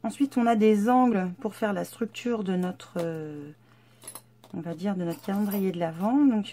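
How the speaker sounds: ordinary talk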